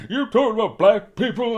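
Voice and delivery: high, loud mocking accuser. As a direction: throw the accusation back, loud. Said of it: "just in case they're like".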